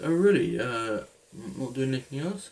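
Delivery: high, loud feigned surprise; mock surprise.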